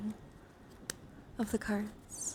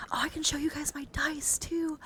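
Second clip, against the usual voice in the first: high, louder, excited burst; 8 semitones higher, 4 dB louder than usual. Real, not acted. Streamer offering to show viewers the dice, excited. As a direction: blurt it out with sudden delight. Suddenly thinks of showing dice.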